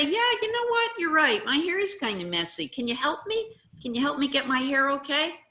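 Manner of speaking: high, loud demonstrated agreement; agreeable owning up. Pretend. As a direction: disarm the bully by agreeing brightly. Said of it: "you say".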